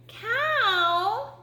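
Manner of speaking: high, drawn-out kitten call; high little kitten voice. Acted. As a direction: call to the cow as a small kitten. Kitten addresses cow.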